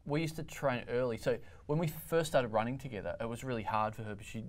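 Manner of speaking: ordinary talk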